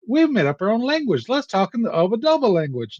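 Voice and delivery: put-on high, silly kids' show host voice; mocking kids' TV voice. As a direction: ham up a goofy children's presenter. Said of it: "they were like".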